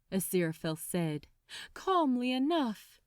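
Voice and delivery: ordinary delivery, nothing marked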